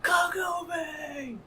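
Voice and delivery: high, drawn-out anime cry; stretched high-pitched character wail; Inuyasha. Acted. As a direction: call out a name in a long, high yell. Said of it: "You do a solid Inuyasha yell".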